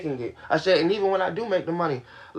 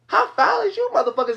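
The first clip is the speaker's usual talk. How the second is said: outraged disbelief; 12 semitones higher than usual. Real, not acted. Ranting at viewers who side with her brother, outraged and high. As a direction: pitch leaps way up in outrage.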